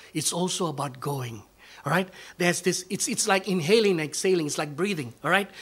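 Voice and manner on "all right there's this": ordinary talk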